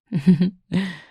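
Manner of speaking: a loud, teasing laugh; amused laugh after the jab. Real, not acted. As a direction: laugh at putting them in place.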